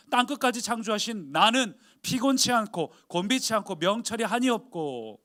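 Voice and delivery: building from stern to loud proclamation; God's rising rebuke. Retold or imitated. God answering Israel's grumbling, building to a loud declaration. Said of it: "하나님도 불평하시는 거예요".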